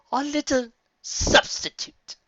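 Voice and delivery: high, slow predatory purr; menacing villain; leering dark queen. A narrator's reading. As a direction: savour the words hungrily.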